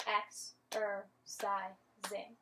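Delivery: Ex-er-cising said slowly, each syllable split apart; slow teaching breakdown of Ex-er-cising. Shown as a demonstration. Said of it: "Let's do it one more time".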